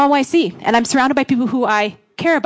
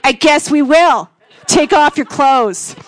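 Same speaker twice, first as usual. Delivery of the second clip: loud, slow, grudging order; mock hateful resignation; 3 semitones higher, 6 dB louder, much slower than usual. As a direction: order it loudly, dragging the words out with resentment. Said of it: "there's just this hatred between you".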